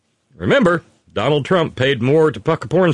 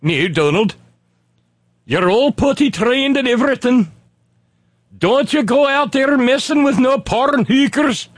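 put-on higher, scolding old-lady voice; Marianne Trump impression; 9 semitones higher than usual. Host doing Marianne Trump scolding her brother Donald, higher scolding voice. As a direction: scold Donald like his stern older sister. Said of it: "my Marianne Trump impression".

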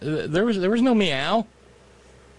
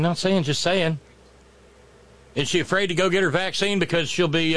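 ordinary talk, nothing marked

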